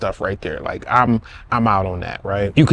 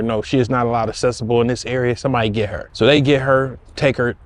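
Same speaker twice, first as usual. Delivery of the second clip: usual speaking voice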